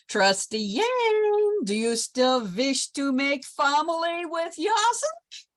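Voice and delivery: put-on very high, slow call in a Polish accent; mock yell across a hall. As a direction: shout it across a crowded hall. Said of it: "He yells".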